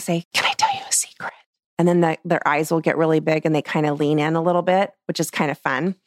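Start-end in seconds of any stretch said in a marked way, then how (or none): 0.3-1.3 high, breathy whisper; whispering a secret to a child. Shown as a demonstration. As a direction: lure them in with a whisper. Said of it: "I might go quiet".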